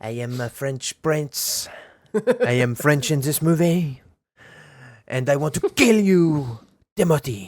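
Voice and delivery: mocking Pattinson with a bad French accent. Retelling the film to her co-host in a mock French accent. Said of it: "Robert Pattinson's got a terrible French accent".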